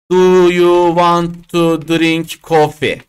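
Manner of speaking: slow, word-by-word textbook English; over-slow stilted English. Shown as a demonstration. Teacher showing students the wrong way to offer coffee, slow and stilted. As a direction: plod through the whole sentence. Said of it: "Bunu yapma".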